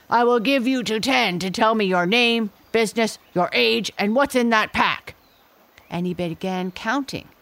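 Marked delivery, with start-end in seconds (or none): 0.1-5.1 louder, higher, bossy gorilla; a brute rattling off demands; fierce gorilla voice. A narrator's reading. Gorilla interrogates.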